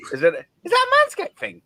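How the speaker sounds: squeaky mock question. Mock-indignant repeat of question.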